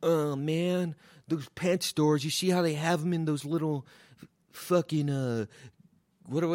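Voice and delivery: comic mocking the hippie's pet store lecture.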